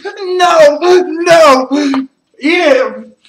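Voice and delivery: loud, high shouts of no; shouting. Real, not acted. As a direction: yell at them to eat it. Partner refuses to eat.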